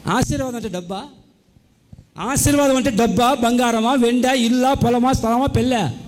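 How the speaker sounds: loud, mocking rundown of their boasts; forceful preaching. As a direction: hammer each claim home, scoffing. Mocking repeated claims loudly.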